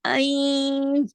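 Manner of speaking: आई held very long, humming into the nose; slow, steady nasal stretch on the end of आई; nasal merging. Shown as a demonstration. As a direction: sustain आई with the n only half sounded. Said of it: "इस तरीके से इसको इदगाम करते हुए".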